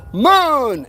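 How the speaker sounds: retold yell. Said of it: "I yelled out".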